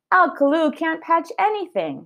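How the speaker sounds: a hint of Amelia scoffing; lightly voiced Amelia. Teacher reading aloud, Amelia doubting Al Kalou, lightly voiced.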